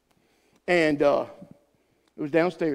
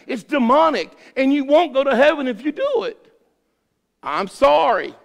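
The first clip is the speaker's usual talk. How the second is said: louder and higher, driving the point home; preaching at full force; 9 semitones higher, 7 dB louder than usual. Acted. Pastor warns church gossipers with fiery preaching. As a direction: hammer it at the congregation.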